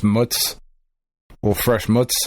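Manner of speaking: "mutz" clipped to one syllable, rhyming with puts; Italian-American pronunciation. Shown as a demonstration. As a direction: say "mutz" for mozzarella, cut short with a ts. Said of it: "pronounced mutz".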